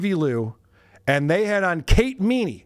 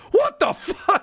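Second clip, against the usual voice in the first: a high, laughing mock outrage; mock outraged squeal; slower than usual. Mimicking John's outrage at a donation, high laughing squeal. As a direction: squeak it out in fake shock. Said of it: "he was".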